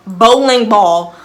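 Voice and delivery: "Bowling ball" stretched out loud and slow; incredulous emphasis. Real, not acted. Storyteller scoffs at the claimed weapon, incredulous stress on "Bowling ball".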